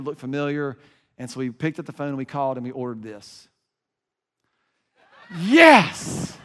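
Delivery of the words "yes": high, loud burst of triumph; triumphant shout. Real, not acted. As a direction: shout it out like a cheer. Cheers as the ordered food is revealed.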